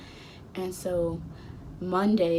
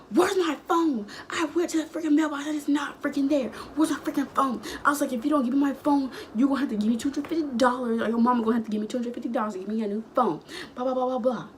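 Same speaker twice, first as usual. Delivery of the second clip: spazzing out; 7 semitones higher, faster than usual. Retold or imitated. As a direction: go off on him, pitch way up. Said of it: "And so I'm spazzing".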